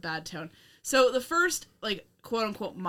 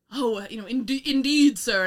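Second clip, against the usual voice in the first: put-on old-timey gentleman; 4 semitones higher, 7 dB louder than usual. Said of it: "tipping their top hat".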